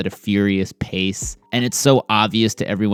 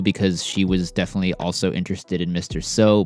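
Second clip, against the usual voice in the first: ordinary delivery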